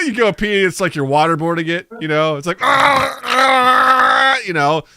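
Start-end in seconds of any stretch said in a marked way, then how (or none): 2.6-4.4 high, loud sputtering cries; choking yells. Pretend. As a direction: yell as if drowning. Waterboarded toilet yelling.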